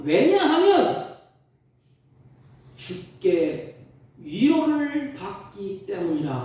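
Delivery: fervent preaching. Acted. Pastor proclaiming to the congregation, fervent loud preaching. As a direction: cry it out from the pulpit.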